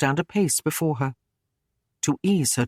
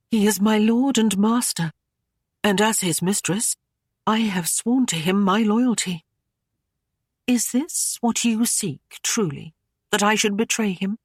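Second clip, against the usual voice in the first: higher, puzzled and guarded lady; gently bewildered, pitched up; higher maidenly voice, confused; young lady, voiced higher; 5 semitones higher than usual. Aislinn's voice in narration.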